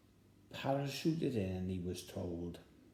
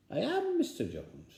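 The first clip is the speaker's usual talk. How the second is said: much higher, proud announcement; Mister Jones voice; 13 semitones higher, 4 dB louder than usual. Acted. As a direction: announce yourself high and grand. Mr Jones answers door.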